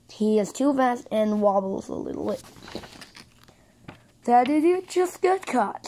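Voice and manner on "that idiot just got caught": high, gleeful taunt about a rival's mistake; happy gloating. A narrator's reading. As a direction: crow happily over someone getting caught. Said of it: "says blue happily".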